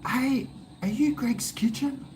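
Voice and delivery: put-on high girly fan voice; imitating a young girl fan, high; girl's voice. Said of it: "she goes".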